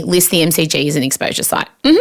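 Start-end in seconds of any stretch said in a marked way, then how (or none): 1.8-2.0 reassuring. Real, not acted. Soothing listeners about covid.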